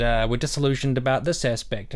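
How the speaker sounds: ordinary talk, nothing marked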